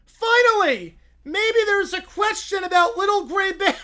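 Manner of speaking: high, loud eager shout; excited kid. Acted. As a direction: burst out with childish joy.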